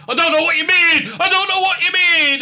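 high, loud defensive denial; mock flustered shout. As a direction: yell it like a caught-out soap heroine.

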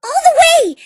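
loud, high cheer; shouting with glee at the idea; excited cheer. Acted. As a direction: throw it out at full joy. Enthusiastic cheer.

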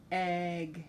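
plain reading voice

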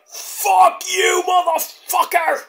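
high yelled curse at his opponent; angry shout. Retold or imitated. Mox yelling a curse at Rush, mock shout. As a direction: bellow it like a furious wrestler. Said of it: "gets up and is like".

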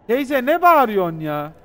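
loud and slow, calling out over the noise; exasperated shout. Real, not acted. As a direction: shout back at the shrieking auntie. Yelling back at a screaming auntie. (bağıran teyzeye yüksek sesle ve yavaşça sitem etme)

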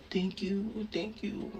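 "Thank you" murmured quietly, half asleep; faint sleepy thank-yous; soft groggy thanks. Retold or imitated. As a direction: whisper your thanks drowsily. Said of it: "ako daw, thank you lang ng thank you".

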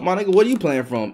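ordinary talk

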